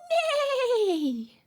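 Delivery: bright high neigh with a laugh; whinny like a horse for the baby; playful horse neigh. Retold or imitated. Mother neighs like a horse for her baby, laughing.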